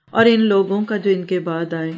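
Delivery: usual speaking voice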